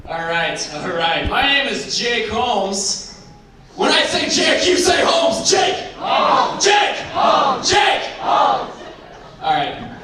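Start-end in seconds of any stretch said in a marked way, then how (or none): 3.7-8.7 high shouted crowd chant; call and response. Acted. Comic leading audience call-and-response, shouted chant. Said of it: "get the energy up in the room".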